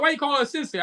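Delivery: quoted curious fan. Retold or imitated. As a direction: lightly voice a fan asking. Quotes women at art shows asking.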